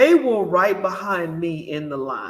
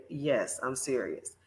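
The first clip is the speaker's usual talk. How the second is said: quietly sure; 6 semitones lower, 9 dB quieter than usual. Retold or imitated. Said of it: "And I said".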